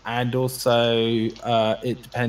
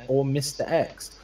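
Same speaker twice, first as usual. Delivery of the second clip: ordinary talk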